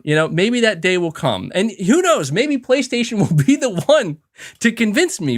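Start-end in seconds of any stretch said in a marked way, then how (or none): none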